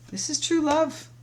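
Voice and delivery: thrilled, high-pitched "true love"; delighted emphasis. Real, not acted. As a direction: lift it up with real joy, leaning on "true".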